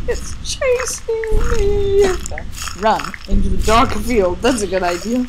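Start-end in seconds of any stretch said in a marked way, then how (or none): 0.1-2.1 high, drawn-out panic; scared, higher and slow. Real, not acted. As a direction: stretch it out in fear.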